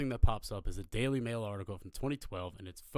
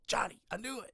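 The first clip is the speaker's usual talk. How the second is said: retold excitement; 12 semitones higher than usual. Teller's gleeful reaction to the name.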